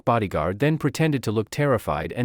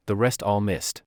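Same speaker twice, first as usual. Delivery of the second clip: plain reading voice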